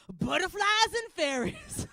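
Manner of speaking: high, loud, slow sing-out; mock cheerful. Mother lying to keep daughter happy, mock cheerful announcement. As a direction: announce it with overblown joy. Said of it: "I just wanted to be happy".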